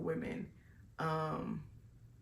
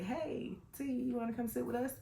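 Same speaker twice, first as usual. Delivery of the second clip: high cheerful call; 7 semitones higher than usual. Retold or imitated. Calling over an upset newcomer.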